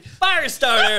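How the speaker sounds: slow, loud, laughing shout of the title; hype announcer. Pretend. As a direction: belt out the title like a trailer. Announcing the next movie title.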